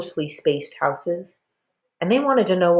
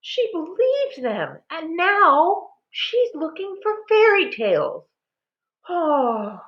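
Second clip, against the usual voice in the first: high, slow tearful lament; weeping; 12 semitones higher, slower than usual. A narrator's reading. Mother weeping over her runaway daughter, high tearful. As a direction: keep crying through the words. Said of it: "Ma sobbed".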